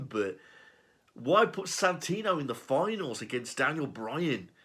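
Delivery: ordinary delivery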